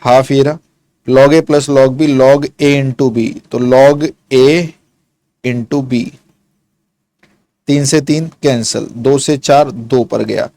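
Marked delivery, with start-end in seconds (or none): 1.1-6.0 each word stretched out slowly; slow dictation. Shown as a demonstration. Teacher dictating a crucial step to students, slow and deliberate. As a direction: dictate it slowly so they can write. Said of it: "काफ़ी crucial step होगा".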